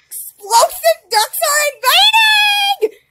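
high loud slow dramatic call; excited announcement. Real, not acted. Gamer calling out the event, loud dramatic. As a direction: announce it like big news.

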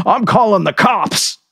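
high, loud ordering voice; angry cook. A narrator's reading. Cook ordering the narrator to leave, angry. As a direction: order him off the lot. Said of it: "the short order cook said".

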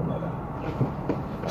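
breathy whispered tag word; whispered aside. Real, not acted. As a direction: drop to a whisper on the last word. Storyteller's aside to the audience.

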